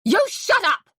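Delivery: loud, high "You shut up!"; shout. A narrator's reading. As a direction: yell it at your wife. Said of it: "he shouted".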